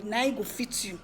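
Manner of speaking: normal talking voice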